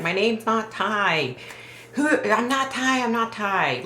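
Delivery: retold frantic protest, pitched high; frantic little boy. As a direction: plead like a panicked child, at ordinary volume. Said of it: "the little boy is frantic".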